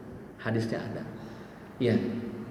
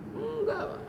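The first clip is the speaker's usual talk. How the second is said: mock answer; 8 dB quieter, slower than usual.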